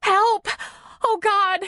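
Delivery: terrified scream. A narrator's reading. Nora calls for help as a bear attacks, terrified scream. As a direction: scream out in terror, very high. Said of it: "She screamed again and added".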